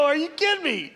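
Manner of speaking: high, laughing "are you kidding me"; laughing disbelief. Retold or imitated. Pastor quoting himself to the sheriff, laughing.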